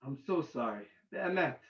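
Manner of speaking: very soft, low apology; subdued, retold sorry; hushed apology. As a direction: apologize gently, almost under your breath. Apologizing to the lady.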